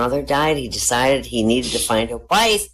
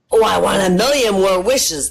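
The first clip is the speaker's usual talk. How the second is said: eager hiker; 5 semitones higher, 4 dB louder than usual. Acted. Hiker speaks.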